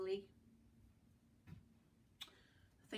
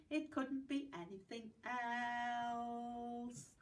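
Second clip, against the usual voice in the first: slow; 3 dB quieter, much slower than usual. Shown as a demonstration. Rhyme closing line.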